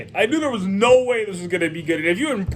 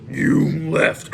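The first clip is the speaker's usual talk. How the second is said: low, rough gravelly growl; deep gravelly voice; 4 semitones lower than usual. Retold or imitated. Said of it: "this really deep, gravelly voice".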